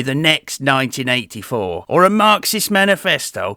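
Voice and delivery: normal talking voice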